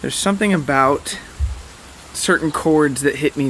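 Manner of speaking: usual speaking voice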